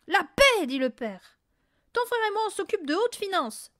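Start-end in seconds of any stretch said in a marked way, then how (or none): 0.1-0.7 sharp rebuke. A narrator's reading. Father snaps at Mathilda. 1.9-3.7 haughty dismissal. A narrator's reading. Father brushes off Mathilda.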